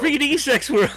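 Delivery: high gleeful repeat; gleeful echo. Pretend. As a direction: relish each word. Repeating with glee.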